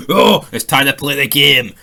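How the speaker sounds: slow, loud wrestler growl of a promo; menacing heel wrestler boasting slowly and loudly; Triple H impression. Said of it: "Triple H style".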